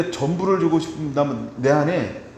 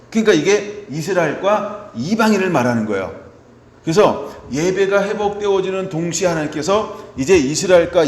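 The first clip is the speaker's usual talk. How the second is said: loud, driving sermon delivery; preaching at full force; 5 dB louder than usual. Acted. Pastor preaching Isaiah 55 to the congregation, loud and driving. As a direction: press each point on the congregation.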